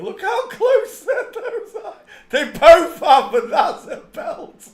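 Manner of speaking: high, slow, loud words through laughter; laughing. Real, not acted. Mocking a face on screen, high laughing. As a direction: barely get it out for laughing.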